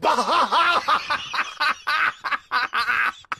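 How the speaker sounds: a big shouted laugh at the punchline. Real, not acted. Laughing at his own punchline.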